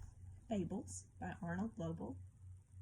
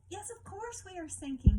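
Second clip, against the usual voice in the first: high, bright lobster voice, unbothered; breezy lobster laughing off danger; cheerful lobster; 11 semitones higher, 7 dB louder than usual. A narrator's reading. Lobster answering the crab calmly, high and cheerful. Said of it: "said the lobster".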